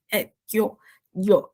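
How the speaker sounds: yo with a faint D before the Y, low; subtle D in yo. Shown as a demonstration. Teacher showing how yo begins with a hint of D. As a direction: start yo with a barely there D. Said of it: "um D bem sutil no começo".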